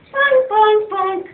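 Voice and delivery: high twangy bunk bunk; plucked ukulele sound. Acted. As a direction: mimic plucking ukulele strings. Imitating ukulele.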